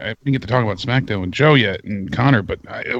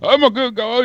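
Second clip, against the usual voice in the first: dazed Shawn Michaels imitation; 13 semitones higher than usual. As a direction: mimic a confused wrestler. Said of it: "his eyes all fucking crossed".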